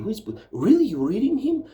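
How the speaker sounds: a put-on incredulous American voice; mocking bookstore people. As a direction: pitch way up and parody their puzzled question. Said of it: "people say".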